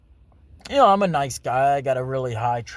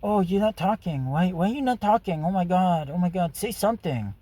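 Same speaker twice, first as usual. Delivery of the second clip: high-pitched mock nagging of a date; imitating pestering date; 6 semitones higher than usual. Mimicking a date pushing him to talk, pestering and high.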